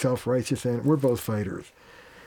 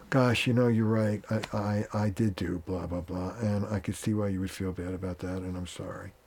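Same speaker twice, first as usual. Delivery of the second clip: soft, lowered, humble apology, fading to almost nothing; sincere apology; 4 semitones lower, 6 dB quieter than usual. Shown as a demonstration. To his angry wife, a soft, sincere, owning apology. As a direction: own it gently and let the voice sink. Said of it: "But an A is".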